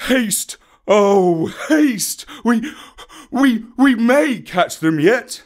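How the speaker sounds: breathless, urgent pleading; panting. A narrator's reading. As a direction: pant out the words. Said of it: "Baloo panted".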